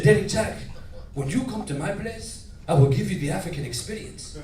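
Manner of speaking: put-on measured African-accented friend. African homeboy inviting him over, African accent. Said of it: "I had one African homeboy".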